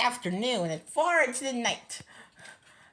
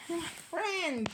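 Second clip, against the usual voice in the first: tiny voice; 4 semitones higher, 9 dB quieter than usual. Acted. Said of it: "came the voice".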